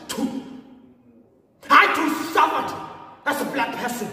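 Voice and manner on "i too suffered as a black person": loud, high insistence; indignant protest. Acted. Claiming a share in the suffering, indignant. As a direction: raise the voice in wounded protest.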